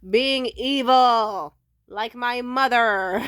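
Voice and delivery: slow, loud ominous drawl; dramatic slow emphasis. Real, not acted. Listing her exes to viewers, slow loud ominous emphasis. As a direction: drag out each word for drama. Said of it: "dramatic drawn-out punchline".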